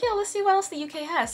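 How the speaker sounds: high, quick eager voice; bubbling with excitement about new dates; excited. Real, not acted. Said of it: "I was kinda excited".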